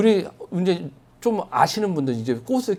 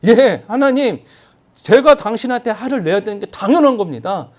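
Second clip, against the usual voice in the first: higher, louder cheeky defiance; defiant retort; 6 semitones higher, 7 dB louder than usual. Retold or imitated. Jonah talking back to God, loud defiant retort. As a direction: talk back boldly to God. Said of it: "라고 말하는 거예요".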